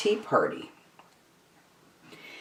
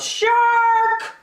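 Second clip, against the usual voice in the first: very high sung-out cry of shark; a prankster's high alarm call; long high shout across the pool; yelled alarm; 4 dB louder than usual. A narrator's reading. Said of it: "He stood up and yelled".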